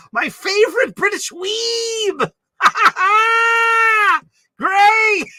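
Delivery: high, loud, drawn-out shout; Jurgen Prochnow. Acted. Hailing a favorite British weeb, high shouted movie-actor impression. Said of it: "I was ripping off Jurgen Prochnow from Dune".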